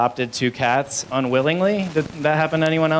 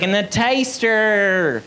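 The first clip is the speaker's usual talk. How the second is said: higher, drawn-out mimicked exclamation; exclaimed quote; 6 semitones higher, 3 dB louder, much slower than usual. Man pointing at a machine, mimicked high and drawn out. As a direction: stretch it out high, imitating him.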